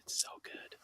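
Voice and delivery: breathy whisper of craving. Real, not acted. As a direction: whisper it like a treat. Dreamy aside about salmon.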